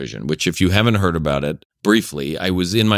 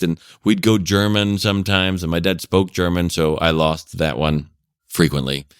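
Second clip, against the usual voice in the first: usual speaking voice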